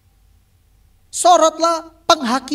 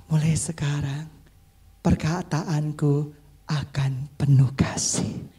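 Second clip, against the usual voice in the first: low, slow, hushed sincerity; tender near-whispered promise; loving vow; 10 semitones lower, 3 dB quieter, slower than usual. Shown as a demonstration. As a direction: say it softly and slowly, with love. Models a loving vow for congregation.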